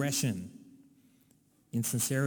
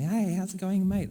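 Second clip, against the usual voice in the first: chirpy greeting; 7 semitones higher, 4 dB louder than usual. Retold or imitated. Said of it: "someone's going".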